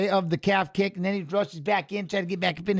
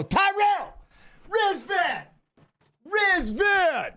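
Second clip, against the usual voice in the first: calling out fighters; 9 semitones higher, much slower than usual. Real, not acted. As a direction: hype them up from the couch. Cheering fighters by name.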